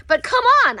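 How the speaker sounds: high, loud exasperated come on; real insistent exclamation; emphatic. Real, not acted. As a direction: throw it out with full emphasis.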